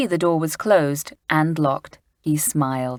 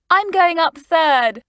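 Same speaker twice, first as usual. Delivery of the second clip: put-on higher, louder slow girl's call; crying out; 12 semitones higher, 7 dB louder, slower than usual. A narrator's reading. Lucy claiming third, excited. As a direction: shout your place in line. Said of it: "cried out Lucy".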